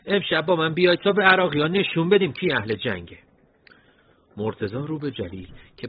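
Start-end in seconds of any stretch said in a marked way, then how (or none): 0.1-3.2 put-on high, loud voice with an Isfahani accent; rally the fighters in an Isfahani accent. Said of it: "با لهجهٔ اصفهانیش گفت". (با لهجهٔ اصفهانی و صدای بلند)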